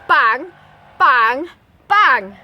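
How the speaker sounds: loud bang bang bang; loud door slams; three door bangs. Acted. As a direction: slam each one. Three doors slammed.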